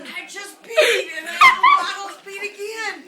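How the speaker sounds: shrieking with laughter through the words; loud helpless laughter, near tears; laughing hard. Real, not acted. Laughing fit over strong oil.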